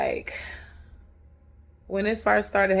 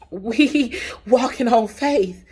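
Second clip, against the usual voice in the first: laughing; 4 semitones higher, 8 dB louder, much slower than usual. Real, not acted. Laughing through her faith line.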